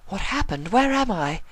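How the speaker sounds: high bewildered waking questions; confused Rarity. A narrator's reading. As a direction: come to in a strange bed. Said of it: "she asked, confused".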